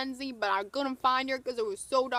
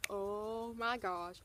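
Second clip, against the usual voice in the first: slow, drawn-out, softer reaction; awed disbelief; 5 dB quieter, much slower than usual. Retold or imitated. Fan watches the star sass someone, slow retold amazement. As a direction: stretch it out in hushed amazement.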